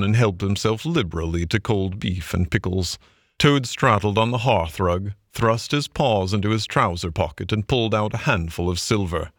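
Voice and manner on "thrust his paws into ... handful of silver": ordinary delivery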